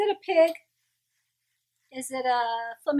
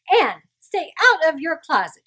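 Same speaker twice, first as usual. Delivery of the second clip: louder, higher final command; firm parting order; 6 semitones higher, 8 dB louder than usual. A narrator's reading. Mother ordering her son out of the closet, louder. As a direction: bark the last order at him. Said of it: "she added".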